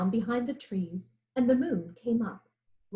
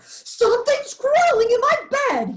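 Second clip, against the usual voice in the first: much higher, louder panicked yell; yelling lion; 13 semitones higher, 9 dB louder than usual. A narrator's reading. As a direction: shriek about ants in bed. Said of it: "he yelled".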